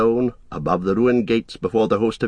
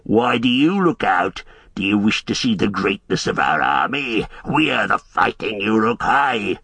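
brutish orc brag; lightly voiced orc boast. A narrator's reading. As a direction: show off the army as a swaggering orc. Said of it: "The orcs yelled and jeered".

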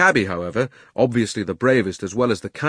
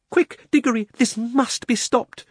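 put-on high-pitched urgent girl's call; 14 semitones higher, 3 dB louder than usual. A narrator's reading. Polly urging Diggory to stop the witch, urgent and high. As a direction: urge him on, pitched up high. Urgent plea to Digory in fiction.

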